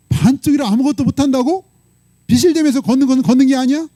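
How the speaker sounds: high-pitched, hammering rhetorical questions; God pressing hard. Retold or imitated. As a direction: scold as an acted God, pushing for answers. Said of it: "하나님도 막 이렇게 몰아붙일 수 있나 봐요".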